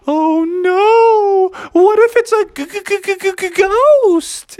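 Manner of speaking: high, stuttering mock fright; hammed-up scared squeal; spooked. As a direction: stammer in exaggerated terror. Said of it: "everybody started to get spooked".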